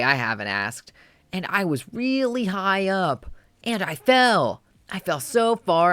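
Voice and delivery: put-on an excited little boy bragging about his day; excited kid's diary.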